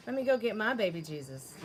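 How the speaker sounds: ordinary talk, nothing marked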